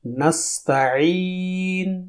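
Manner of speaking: long slow drill of نستعین; soft slow drawn ain. Shown as a demonstration. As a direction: draw out نستعین gently. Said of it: "اسے سنیے".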